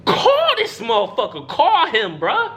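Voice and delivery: high-pitched exasperated demand; retold exasperation. Player to trainer texting the doctor, retold exasperation. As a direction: plead in disbelief, voice climbing.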